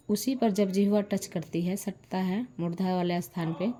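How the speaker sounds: ordinary talk, nothing marked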